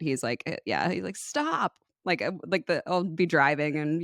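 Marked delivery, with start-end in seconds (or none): 1.2-1.7 frustrated mock yell. Imitating someone bothered by wind, frustrated mock yell. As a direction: imitate a frustrated yelp, kept small. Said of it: "Everett will yell at wind and sunlight".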